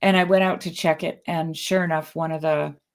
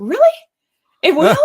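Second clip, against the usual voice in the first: surprised; 12 semitones higher, 8 dB louder than usual. Retold or imitated. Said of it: "I was like".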